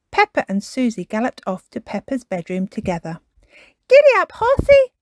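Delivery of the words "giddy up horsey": put-on high, loud child character shout; excited shout. A narrator's reading. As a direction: shout it out as if riding off at a gallop. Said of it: "shouted Peppa".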